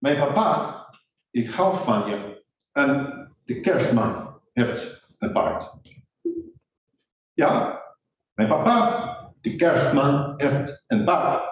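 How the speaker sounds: slow, careful Dutch from a learner; slowly spoken Dutch, an English learner's best try. Shown as a demonstration. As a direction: deliberately sound out each Dutch word. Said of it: "Let me try this".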